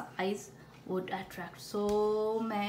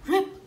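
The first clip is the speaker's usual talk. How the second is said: loud high rip; sudden sharp rip; tearing sound; 9 semitones higher, 9 dB louder than usual. A narrator's reading. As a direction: snap out the tearing noise. Costume tearing.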